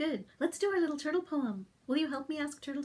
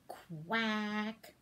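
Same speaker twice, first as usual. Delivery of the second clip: soft low quack; a hint of a duck; 5 semitones lower, 4 dB quieter than usual. The reader asks about the quack in a duck voice.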